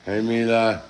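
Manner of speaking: high, projected greeting calls; calling out greetings. Real, not acted. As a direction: call hello to each one across the room.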